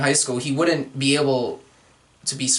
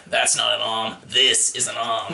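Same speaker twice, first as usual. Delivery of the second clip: lower, slow Aussie parody; Crocodile Dundee line; 6 semitones lower than usual. Pretend. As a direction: deliver it like the famous knife line. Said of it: "Doctor Connors".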